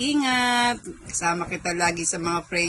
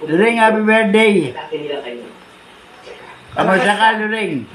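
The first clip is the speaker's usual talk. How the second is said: loud greeting; 8 dB louder than usual. Real, not acted. Elderly man greeting loudly, prompted.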